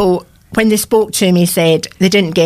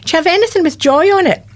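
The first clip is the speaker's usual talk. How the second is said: bright eager question; 8 semitones higher than usual. Retold or imitated. Retold shop question, bright.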